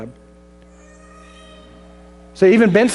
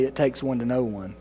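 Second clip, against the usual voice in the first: low, much softer answer from God; a hushed, lower voice of God; God's quiet rebuke; 6 semitones lower, 9 dB quieter than usual. Acted. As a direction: drop down low and quiet, a calm divine correction. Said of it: "And God might say".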